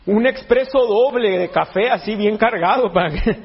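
ordinary talk

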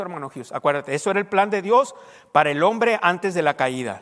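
ordinary delivery

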